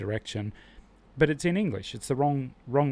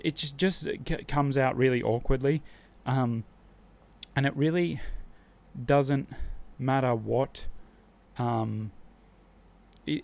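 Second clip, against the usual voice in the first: ordinary talk, nothing marked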